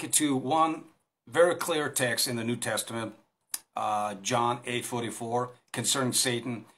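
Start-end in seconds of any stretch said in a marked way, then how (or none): none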